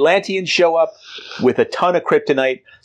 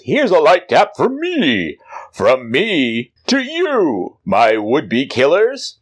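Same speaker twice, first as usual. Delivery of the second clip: heroic, slow defiant quip; Superman voice; 3 semitones higher, slower than usual. Acted. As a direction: deliver a cool hero one-liner. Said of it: "Superman gets up and says".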